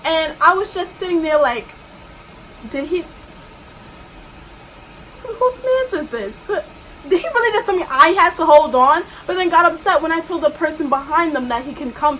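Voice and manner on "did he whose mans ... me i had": incredulous. Real, not acted. Indignant reaction to the customer.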